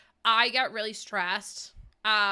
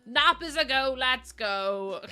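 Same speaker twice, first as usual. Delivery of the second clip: normal talking voice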